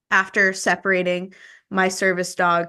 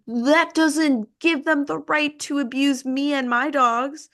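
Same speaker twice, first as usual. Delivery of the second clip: higher-pitched indignant protest; indignant retort; 8 semitones higher than usual. Retold or imitated. Victim confronting the owner of the attacking dogs, indignant. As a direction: stand up for yourself, voice lifted in outrage.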